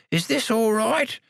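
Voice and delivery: high, slow toothless call; high frail call; calling old man. Acted. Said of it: "called Samuel".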